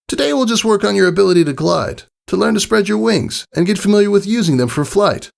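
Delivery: Rainbow Dash voice. A narrator's reading. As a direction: instruct the nervous filly like a sporty coach. Flight lesson in character.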